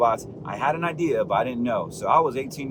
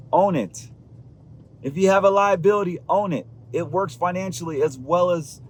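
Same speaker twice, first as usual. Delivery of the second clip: higher, louder sermon-style push; fired-up preaching; 5 semitones higher, 3 dB louder than usual. Acted. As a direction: preach it like a motivational pastor. Motivational preaching, rising intensity.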